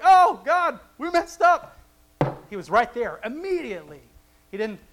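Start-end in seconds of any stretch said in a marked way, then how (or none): 0.0-1.8 high, loud mock wail; hammed-up plea to God; mock repentant cry. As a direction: cry out like sorry sinners.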